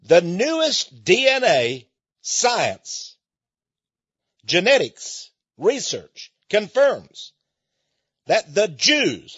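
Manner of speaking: very slow, every word spaced out; slow repeated statement. Shown as a demonstration. Repeating the DNA claim for listeners, slowly. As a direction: spell it out slowly for people who missed it. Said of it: "I'm gonna repeat it again".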